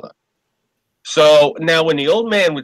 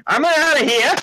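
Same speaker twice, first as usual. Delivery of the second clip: higher, yelling mock Italian accent; hammed-up Italian rage; furious old immigrant. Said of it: "and the guy".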